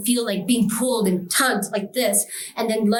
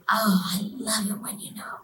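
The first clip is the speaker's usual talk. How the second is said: breathy whispered gloat; gloating devil; 4 dB quieter than usual. Retold or imitated. Devil delighted to be named, gloating whisper. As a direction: savour it in a creepy half-whisper.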